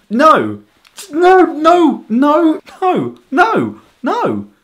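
loud, high-pitched repeated refusal; exasperated denial. Acted. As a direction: shoot it down loudly, pitch leaping up. Rejecting the two-accents stereotype.

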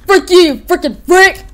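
high, loud furious yelling; angry yell. Retold or imitated. Toy fighter yelling at his attacker. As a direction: spit it out at the enemy. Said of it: "It's like".

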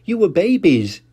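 a hint of chuckling mother; Mummy Pig. Mummy Pig laughing at Peppa's idea. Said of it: "laughed Mummy Pig".

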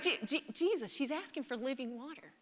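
hushed aside. Retold or imitated. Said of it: "It's like".

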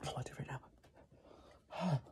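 slow, breathless pained whisper. Real, not acted. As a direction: barely whisper it, drained. Suffering from hot sauce.

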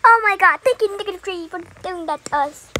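loud, high gushing thanks; play-acted gratitude. Rescued numbers thanking their hero, gushing. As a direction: gush it, starting high.

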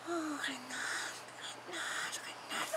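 high, whispery, slow phone voice; Charlotte. Acted. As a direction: whisper it faintly down the line. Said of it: "Puis là, Charlotte a dit". (voix aiguë, lente et chuchotée)

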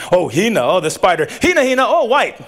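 ordinary delivery

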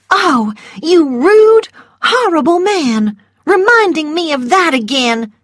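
huffy offended belle; indignant scold of a rude man; Scarlett indignant. A narrator's reading. Scarlett voiced in story.

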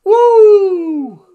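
shrill, very high, loud woo; Ric Flair woo. Retold or imitated. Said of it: "Pulled a Ric Flair".

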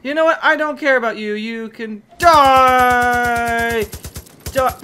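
a drawn-out, hammed-up battle yell; mock furious shout. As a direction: yell at the enemy like a cartoon villain. Turns on the enemy cat.

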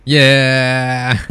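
long, loud "yeah" held out; triumphant yell. Real, not acted. Gamer cheers mid-game, triumphant yell. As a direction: belt out a drawn-out cheer.

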